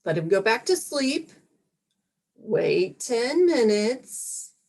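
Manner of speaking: ordinary talk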